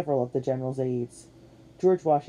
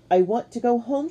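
high, louder whine; childish complaint; 9 semitones higher, 6 dB louder than usual. A narrator's reading. Katie in the cold wanting home, whining complaint. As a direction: complain like an unhappy little kid. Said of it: "she complained".

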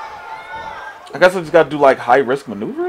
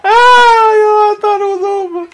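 high shrieking laugh and cry; 6 dB louder than usual. Real, not acted. Surprised loss in game.

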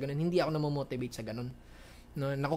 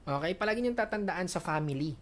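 ordinary talk, nothing marked

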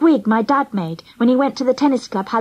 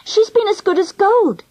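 put-on high, sweetly mischievous girl; mischievous innocence; 8 semitones higher than usual. A narrator's reading. Angela covering up to her aunt, high and mischievous. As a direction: report it brightly with hidden mischief. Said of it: "her eyes all sparkly with mischief".

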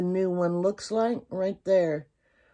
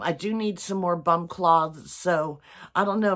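ordinary delivery

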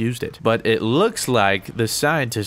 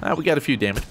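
ordinary delivery

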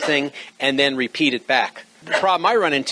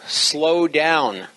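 very slow, deliberate words. Shown as a demonstration. Coach shows radio operators how to slow down, slow delivery. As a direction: draw each word out carefully. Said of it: "Speak slowly and emphasize words".

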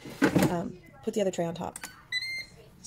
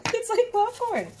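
excited; 9 dB louder than usual. Real, not acted. Excited tasting with daughter.

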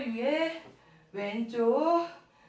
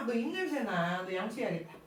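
quiet teasing; 6 semitones lower, 5 dB quieter than usual. Real, not acted.

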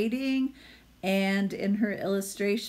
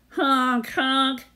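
loud, higher goose honks; honking gander; 6 semitones higher, 7 dB louder than usual. Acted. The noisy gander honks at Hedgie, loud and high. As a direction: honk it out like a big gander. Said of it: "The gander laughed".

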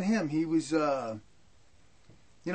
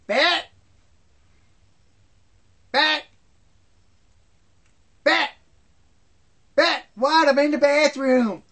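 mock yelling; 7 semitones higher, 8 dB louder, slower than usual. Dad yelling for mom, she answers.

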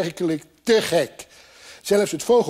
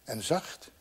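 very soft, low wonder; quiet, awed inner voice; hushed thought; 3 semitones lower, 11 dB quieter than usual. A narrator's reading. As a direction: think it aloud almost inaudibly. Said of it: "dacht hij".

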